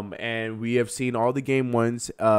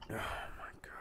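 exasperated whisper; 17 dB quieter than usual. Real, not acted. Exasperated after rant.

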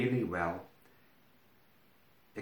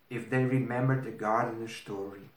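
ordinary delivery

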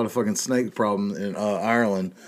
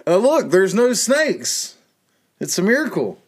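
mock believer; 7 semitones higher than usual. Mock believer insisting on miracle.

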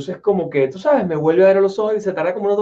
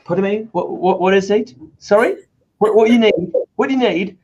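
confused British clerk; 3 semitones higher than usual. Retold or imitated.

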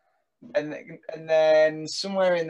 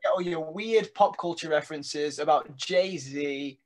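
ordinary delivery

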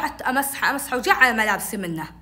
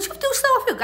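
high-pitched upset appeal; upset complaint; 13 semitones higher than usual. Retold or imitated. Girl complaining to sister about her chick, upset appeal. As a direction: protest to your big sister. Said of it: "قعدت أبكي بكاء".

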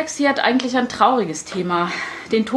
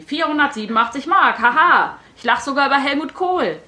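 higher, gleeful granny with a mock haha; boasting grandma; 6 semitones higher than usual. A narrator's reading. Grandma gleefully boasting to her granddaughter. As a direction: crow it out like a cheerful old lady.

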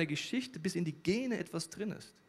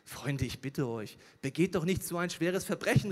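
usual speaking voice

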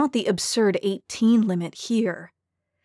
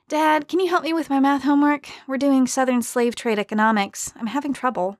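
put-on a higher, girlish pleading voice; lightly voiced young girl; 5 semitones higher than usual. A narrator's reading. Daughter asking her dad for math help, higher girlish voice. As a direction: ask sweetly like an eleven-year-old.

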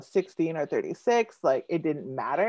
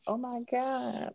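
hushed, drawn-out high mock embarrassment; bashful; 7 semitones higher, 8 dB quieter, slower than usual. Poet recalls her blushing past self, bashful. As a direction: squirm through it softly, stretched out.

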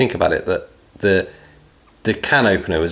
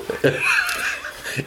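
silent wheezing laugh, much higher; breathy high laugh; 6 dB quieter than usual. Real, not acted. Host cracking up at co-host's joke, wheezing laugh.

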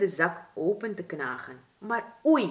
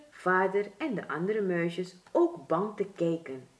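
plain reading voice

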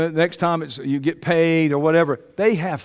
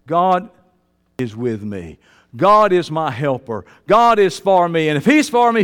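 ordinary talk, nothing marked

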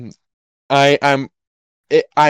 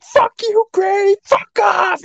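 very high, strained mock scream; mock angry yell; slower than usual. Driver cursing the granny he passes, mock angry yell. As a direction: screech it like a hammed-up road-rager. Acted yelling while passing granny.

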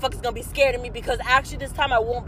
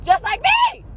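usual speaking voice

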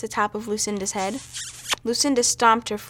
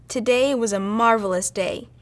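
ordinary delivery

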